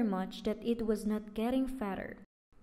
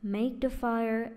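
ordinary delivery, nothing marked

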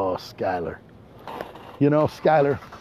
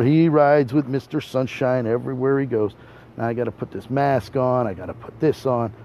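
usual speaking voice

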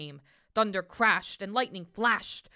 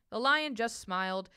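usual speaking voice

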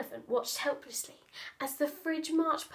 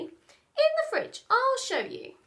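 put-on eager small boy showing the way; eager child; 12 semitones higher, 4 dB louder than usual. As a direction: Patrick leading the inspector. Patrick voiced high.

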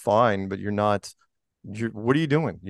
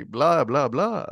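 lightly voiced cartoon vampire; 2 semitones higher, much slower than usual. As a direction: play a mock Dracula for laughs. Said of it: "a vampire and Gru".

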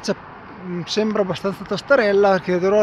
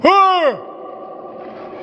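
echo shout; 10 semitones higher than usual. Real, not acted. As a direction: shout into the valley to test it. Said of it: "senti che eco". (grido per sentire l'eco)

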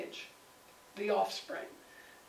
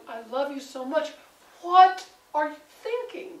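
loud, higher pleading cry on the question; loving exasperation; 5 semitones higher, 9 dB louder than usual. Retold or imitated. A friend pleading with someone doing something stupid, exasperated. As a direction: beg a dear friend to see sense.

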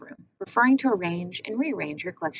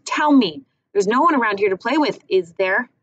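demanding; 3 semitones higher, 6 dB louder than usual. Acted. Gretel demanding answer.

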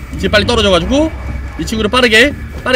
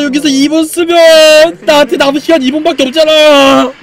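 loud; 4 semitones higher, 7 dB louder than usual. Real, not acted. Panicking over time.

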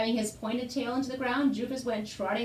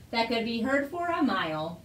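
ordinary delivery, nothing marked

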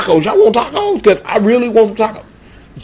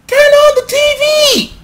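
much higher, louder shriek; high squeal; 3 dB louder, faster than usual. Acted. Hyping the TV on for Birdman, high-pitched yell. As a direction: yell it up high like a hype man.

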